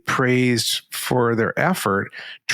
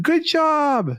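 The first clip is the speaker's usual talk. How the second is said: much higher, drawn-out cheer; gushing praise. Acted. Parent tossing generic praise at a child, gushing. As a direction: chirp it high like over-eager praise.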